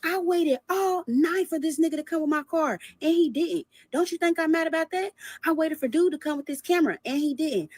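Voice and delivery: high-pitched mocking voice of an indignant man; mock outraged boyfriend. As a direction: imitate him insisting he's the wronged one. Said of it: "like, screaming".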